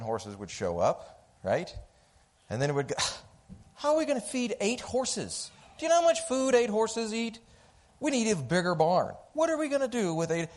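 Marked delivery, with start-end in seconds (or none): 3.7-10.5 high, fast, fretting mother-in-law voice; frantic mother-in-law. Retold or imitated. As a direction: panic over every cost like an anxious in-law. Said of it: "I am talking about my mom-in-law".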